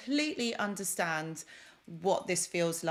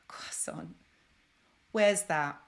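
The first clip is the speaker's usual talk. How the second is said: ordinary talk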